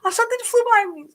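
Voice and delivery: very high, breathy panicked shriek; terrified shriek. Retold or imitated. The creature flies past again, terrified shriek to dad. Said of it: "And I was like".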